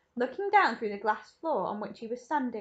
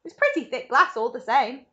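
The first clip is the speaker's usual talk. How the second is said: louder, breezy reassurance; cheerful; 3 semitones higher, 9 dB louder than usual. A narrator's reading. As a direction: brush the danger off cheerfully. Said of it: "cheerful as ever".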